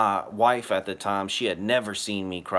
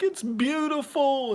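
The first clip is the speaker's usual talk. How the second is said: awestruck. Retold or imitated. Himself awestruck, retelling his reply.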